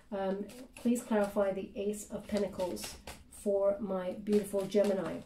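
soft, murmured request to the guides; hushed prayer asking spirit guides for clarity; quiet prayer. Acted. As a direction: ask the cards gently under your breath. Asks the guides during a card pull.

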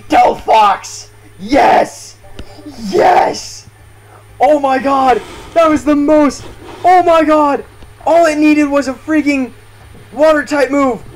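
shrieking jackpot winner. Real, not acted. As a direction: scream like you just hit the jackpot. Winning the battle, screaming.

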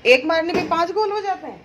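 high, loud playful disbelief; amused disbelief. Real, not acted. To her son boasting about goals, playful loud disbelief. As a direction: tease him with mock astonishment.

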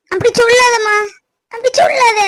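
high, tearful protest; anguished plea. Acted. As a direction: beg with the pitch rising into a cry. Daughter begs mother not to forbid love.

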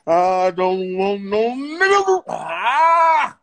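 slow, straining, overacted cry; pushed actor's take. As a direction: push it hard, dragging out each word. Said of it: "Let me go push it".

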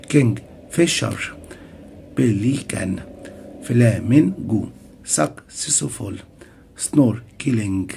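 "بليكان" and the other words broken into three syllables. Shown as a demonstration. Teacher listing three-syllable words, syllable by syllable. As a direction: gaps between syllables, as in "فلامينجو" split in three. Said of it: "طب في كلمات تلات مقاطع".

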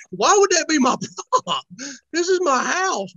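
high-pitched mock indignation; indignant. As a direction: protest it, comically offended. Retold indignant reply about his home.

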